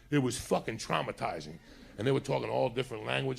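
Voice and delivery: ordinary talk, nothing marked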